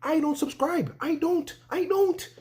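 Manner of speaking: hammed-up squeaky protest; mock refusal. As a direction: refuse flatly, voice rising to a squeak. Acted refusal to pay.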